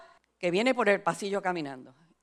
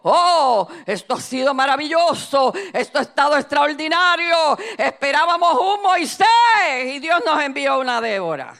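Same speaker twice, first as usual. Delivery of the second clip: raised, proclaiming pastor at the microphone; exultant pastor; 4 semitones higher, 5 dB louder than usual. Retold or imitated. Pastor praises the guest preacher to his church, exultant proclamation. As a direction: marvel aloud to the whole congregation.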